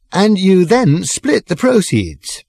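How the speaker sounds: put-on high, slow, drawn-out drunken pronouncement; pompous drunk speech. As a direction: lecture the pub grandly, slowly.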